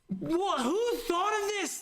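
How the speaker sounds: can't believe someone wrote this; incredulous disbelief. Real, not acted. Streamer reading checklist to chat, high incredulous disbelief. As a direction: pitch shoots up, slow and stunned.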